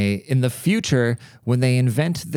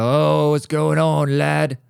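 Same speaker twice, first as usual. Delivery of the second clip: slow, higher mock British lad; terrible British accent; 4 semitones higher, much slower than usual. Imitating a Brit greeting a bro, bad British accent. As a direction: stretch it out in a badly faked British accent. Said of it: "my British accent is really terrible".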